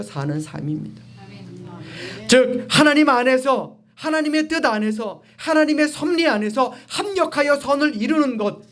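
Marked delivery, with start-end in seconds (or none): 2.3-8.6 high-pitched, insistent preacher cadence; emphatic preaching. Real, not acted. Preacher defining true prosperity to congregation, emphatic preaching. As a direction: drive each phrase home from the pulpit. Preacher drives the point home.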